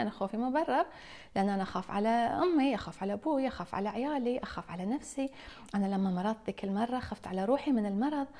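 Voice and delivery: normal talking voice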